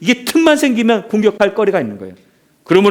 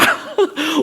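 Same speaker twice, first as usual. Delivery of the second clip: short laugh breaking into the yell; 7 semitones higher, 4 dB quieter than usual. Real, not acted. Preacher cracks up while acting the coach, short laugh. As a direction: burst out laughing mid-shout.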